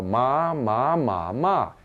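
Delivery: four tones on "ma", each with its own pitch; Mandarin tones. Shown as a demonstration. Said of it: "where you have tones".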